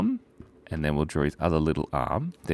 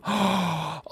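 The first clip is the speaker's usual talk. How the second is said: surprised gasp; 11 semitones higher than usual. Real, not acted. Surprised by a chat message.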